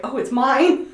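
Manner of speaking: high, loud mock dismayed discovery; retold guilty realization. Mother finding the crying baby is hers, mimicked dismay. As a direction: play up the awful dawning realization. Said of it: "how bad she felt when".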